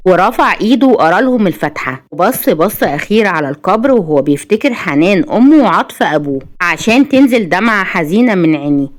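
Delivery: ordinary delivery, nothing marked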